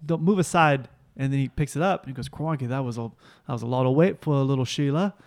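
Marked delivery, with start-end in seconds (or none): 0.1-0.9 slow mock Australian accent; Mark Wahlberg, badly Australian. Playing Wahlberg taking over at the bench, mock Australian accent. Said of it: "Now he's Australian?". 2.3-5.2 put-on cod Australian accent; Mark Wahlberg, badly Australian. Playing Wahlberg after lifting the weight, mock Australian accent. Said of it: "Now he's Australian?".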